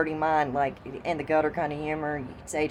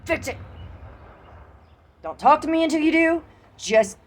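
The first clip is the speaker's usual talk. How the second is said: angry command; 10 semitones higher than usual. Retold or imitated. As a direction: snap at the maintenance man to fix it now. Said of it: "I was just gonna be".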